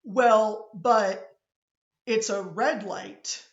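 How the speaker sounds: a question said flat, falling at the end. Shown as a demonstration. Teacher showing how a Pittsburgher asks, falling tune. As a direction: ask it like a statement, the pitch dropping on red light. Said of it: "So I might say something like".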